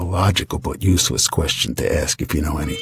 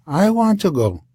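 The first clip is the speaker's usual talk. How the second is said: much higher mock wife voice; imitating the wife; 9 semitones higher than usual. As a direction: insist like a stubborn wife.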